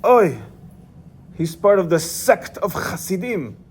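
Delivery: high, loud alarmed father's cry; screamed. Retold or imitated. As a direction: shriek it out like a horrified father. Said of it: "and he screamed".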